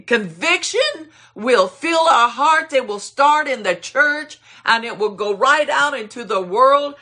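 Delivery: preaching. Acted. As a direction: preach it out to the congregation. Preacher at full force.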